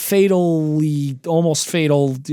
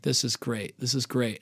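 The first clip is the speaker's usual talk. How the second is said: low, very soft contented murmur; quiet, mellow stoned calm; blissfully chilled; 6 semitones lower, 11 dB quieter than usual. Retold or imitated. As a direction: murmur it low, lost in the view. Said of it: "at first I'm chilling".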